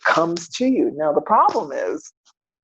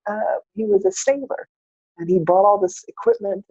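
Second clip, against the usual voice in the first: normal talking voice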